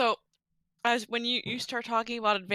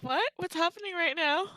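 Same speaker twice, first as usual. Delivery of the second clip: high, puzzled what; baffled and lost; confused; 7 semitones higher than usual. Acted. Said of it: "I look at Koros like".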